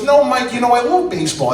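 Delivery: put-on high-pitched Minnesota accent; Minnesota grandma. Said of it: "she's from Minnesota right now".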